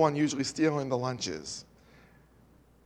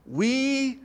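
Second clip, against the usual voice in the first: rising sermon voice, louder on the key lines; preaching; 4 semitones higher, 4 dB louder than usual. Acted. Preacher tells listeners Christ took their punishment, earnest preaching. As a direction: press the message home to the congregation.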